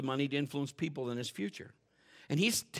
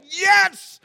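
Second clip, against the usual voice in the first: mock cheer, high and loud; gleeful whoop; cheering yes; 16 dB louder than usual. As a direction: shout it like a happy crowd. Congregation cheering over dinner.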